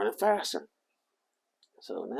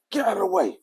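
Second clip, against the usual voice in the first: telling her off; 13 semitones higher, 10 dB louder than usual. Retold or imitated. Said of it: "I pushed her".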